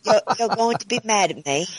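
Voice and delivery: lightly put-on English accent for a friend; hammed-up British accent; bad British accent. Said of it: "I'm gonna really give you a bad accent".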